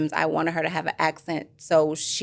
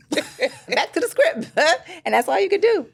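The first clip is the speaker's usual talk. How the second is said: laughing; 12 semitones higher, 4 dB louder than usual. Real, not acted. Laughs over retold set rejection.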